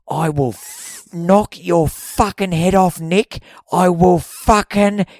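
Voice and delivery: loud, slow, high-pitched threat; threatening shout. Retold or imitated. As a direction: build to a roaring threat, hitting each word. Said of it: "he's looked at the Indian guy, guy and goes".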